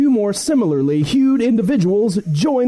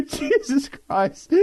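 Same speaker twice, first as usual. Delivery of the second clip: laughing; 8 semitones higher, 4 dB quieter, much slower than usual. Real, not acted. Cracking up after flub.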